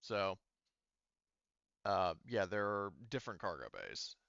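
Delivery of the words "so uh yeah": ordinary talk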